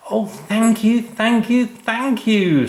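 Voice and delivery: put-on high, loud, thrilled child's squeal; excited squeal. A narrator's reading. Sam squealing thanks to mum and dad, thrilled. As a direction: squeal your thanks with delight. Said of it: "squealed an excited Sam".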